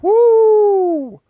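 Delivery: a sudden high, loud whoop of disbelief; startled whoop. Real, not acted. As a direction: yelp out in shocked amusement. Reacting to a weird AI voice clip.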